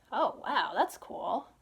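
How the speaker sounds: normal talking voice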